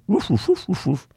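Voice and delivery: high little woofs; dog bark. Acted. Dog hearing the cat, barking woofs.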